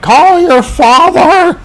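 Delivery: very high, loud, drawn-out scream; frantic shout. Retold or imitated. Mother being arrested, screaming to her son in panic. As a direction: yell it as she's dragged away.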